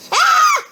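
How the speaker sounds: sudden high shriek; startled scream. Real, not acted. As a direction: yelp in sudden fright. Jump scare in horror game.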